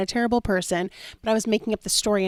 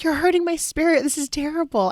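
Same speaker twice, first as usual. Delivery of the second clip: higher-pitched, wounded protest; dramatic complaint; 8 semitones higher than usual. Retold or imitated. Wife protesting her husband's road rage, overdramatic. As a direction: imitate your overdramatic former self. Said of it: "that was so dramatic".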